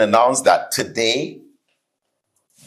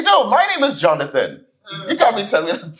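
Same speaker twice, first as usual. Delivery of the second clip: higher, mock-offended insisting; cheeky denial; 8 semitones higher, faster than usual. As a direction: brazenly claim the name, half laughing. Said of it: "He said".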